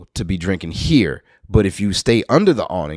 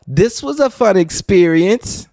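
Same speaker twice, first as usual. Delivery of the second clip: high, slow mock cheer; sarcastically bright; storyteller mocking his own gross encounter; deadpan sarcasm; 12 semitones higher, 5 dB louder, slower than usual. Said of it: "And I was like".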